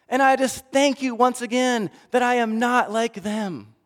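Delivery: pompous praying like a showy saint; boastful Pharisee. Acted. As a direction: proclaim your own virtue to God.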